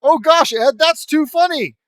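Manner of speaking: very loud, high hammed-up cackling; mock hysterical laughter. Friends react to Ed's impression, over-the-top hysterical laughing. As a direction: explode into fake hysterics, shouting the words. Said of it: "I laugh hysterically".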